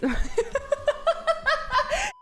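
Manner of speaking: high giggle. Real, not acted. Giggles at a flirty scene.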